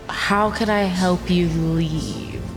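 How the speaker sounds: ordinary talk, nothing marked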